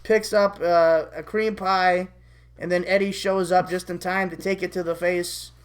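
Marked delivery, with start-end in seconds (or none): none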